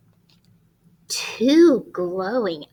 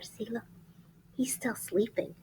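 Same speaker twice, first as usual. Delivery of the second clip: whisper; 11 dB quieter than usual. Real, not acted. Whispering near sleeping bear.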